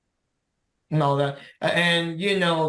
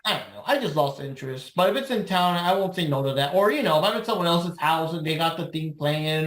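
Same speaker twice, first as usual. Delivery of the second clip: normal talking voice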